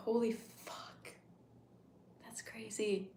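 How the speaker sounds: slow, hushed disbelief; stunned whisper. Real, not acted. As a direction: breathe it out in shock. Stunned by own age realization.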